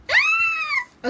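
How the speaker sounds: short, very high squeal; sudden high-pitched shriek of delight; excited scream. Real, not acted. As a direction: let out a piercing little fangirl scream. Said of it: "let me stop screaming".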